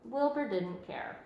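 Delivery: usual speaking voice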